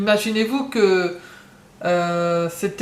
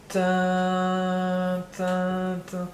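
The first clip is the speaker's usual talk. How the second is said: hummed fanfare; 2 semitones lower, 5 dB quieter, much slower than usual. Pretend. Voices drumroll before reveal.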